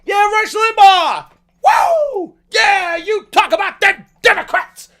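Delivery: loud, high whooping fan cheer; mock cheering shout. Driver mocks himself cheering Rush Limbaugh, loud and high. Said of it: "I'm not doing".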